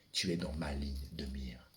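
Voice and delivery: unvoiced, tense whisper; low secret whisper. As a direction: whisper it like a hunter taking aim. Hushed aim at the father.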